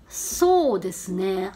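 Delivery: very slow, low, drawn-out そうですね; thinking it over. Shown as a demonstration. Busan resident asked a travel time, pondering slowly. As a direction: stall for time while working out the answer. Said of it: "좀 생각하는데 시간이 걸리니까".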